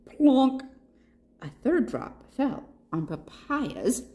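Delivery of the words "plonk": raindrop plonk. A narrator's reading. Raindrop sound effect in story.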